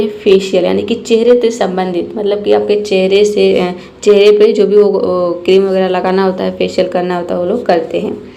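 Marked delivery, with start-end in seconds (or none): none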